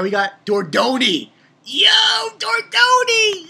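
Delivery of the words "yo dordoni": drawn-out high shout of the name; slow, loud, high excited yell. Real, not acted. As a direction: call the name out like a hype cheer. Hyping a character's entrance.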